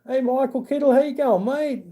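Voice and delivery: cheery greeting. Real, not acted. Greeting Michael Kittle in the chat, high cheerful call-out.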